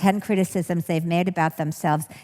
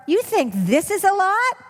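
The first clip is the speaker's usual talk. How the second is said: high, teasing retort; mocking comeback; 12 semitones higher, 3 dB louder than usual. Shown as a demonstration. Speaker showing a humorous reply to the superego, high and teasing. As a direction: tease the critic right back. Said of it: "the way you stop is with humor".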